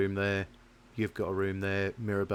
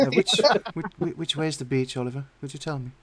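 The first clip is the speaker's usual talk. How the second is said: high put-on voice; 5 semitones higher, 5 dB louder than usual. Character asks in put-on voice.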